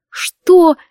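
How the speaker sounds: high, loud indignant cry; outraged. A narrator's reading. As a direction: choke on indignation. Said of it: "едва не задохнулась от возмущения".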